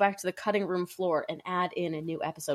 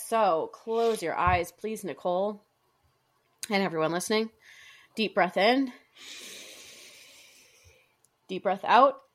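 guided meditation. Acted. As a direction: lead them gently into the vision. Leads a guided visualization.

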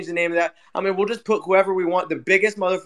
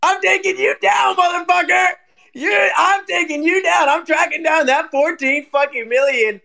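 slow, high, loud mock-menacing shout; mock threat; 9 semitones higher, 3 dB louder than usual. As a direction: shout the threat slowly like a movie villain. Threatening Bart mock seriously.

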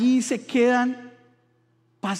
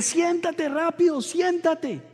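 higher, urgent command to sit down; alarmed father yelling; 8 semitones higher, slower than usual. Retold or imitated. Scared father yelling at his son to sit down, higher urgent command. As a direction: snap at the kid to sit, alarmed.

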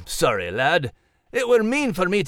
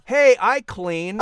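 mock offended; 7 semitones higher, 3 dB louder, slower than usual. Max protests being teased.

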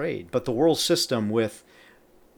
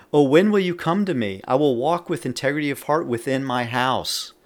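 raised, louder psalm reading; scripture proclamation; 4 semitones higher, 6 dB louder than usual. Acted. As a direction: open high and strong, declaiming the verse. Reads psalm aloud, commenting between verses.

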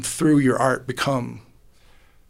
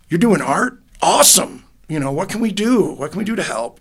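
higher, brighter supportive dad; upbeat dad; 6 semitones higher, 3 dB louder than usual. Retold or imitated. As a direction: cheer him on like an eager father. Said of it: "My dad was more like".

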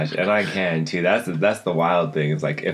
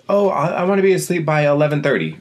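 eager self-talk; 10 semitones higher, 4 dB louder, faster than usual. Retold or imitated. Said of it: "I'm like".

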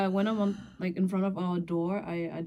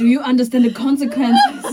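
mock realtor; 6 semitones higher, 14 dB louder than usual. Mocking the realtor, laughing.

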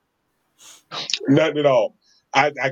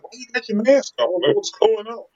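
put-on kids asking; 8 semitones higher than usual. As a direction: kid voice, higher and puzzled. Kids asking about the mask.

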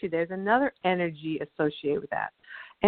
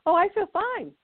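put-on bright relieved boy; 11 semitones higher than usual. Boy after the throat clearing, bright and high. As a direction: sound suddenly free and easy. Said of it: "He said".

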